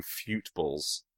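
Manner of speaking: "fute balls" with a yoo vowel; mock English pronunciation. Shown as a demonstration. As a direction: stretch "fute" slowly in "fute balls". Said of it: "we call them fute balls".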